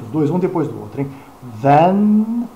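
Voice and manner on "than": Than ending on a clear N. Shown as a demonstration. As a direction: finish Than with the tongue on N. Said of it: "tem que pronunciar o N".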